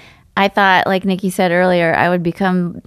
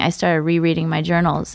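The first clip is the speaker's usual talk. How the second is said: ordinary talk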